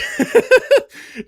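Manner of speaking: loud high-pitched laugh; high burst of laughter. Real, not acted. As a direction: cackle it out loud. Laughing at Monato joke.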